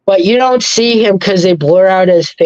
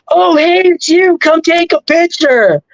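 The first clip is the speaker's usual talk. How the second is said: cheerful greeting; 9 semitones higher than usual. Retold or imitated. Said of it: "but he was like".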